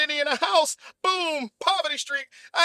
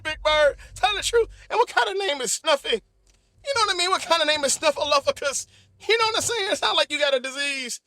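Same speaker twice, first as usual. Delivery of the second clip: ordinary talk, nothing marked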